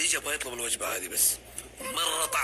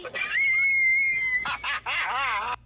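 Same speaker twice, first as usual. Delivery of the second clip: very high screaming laugh; a shrill laugh at the poet's name; shrieking laugh; 6 dB louder than usual. Real, not acted. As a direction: howl with laughter.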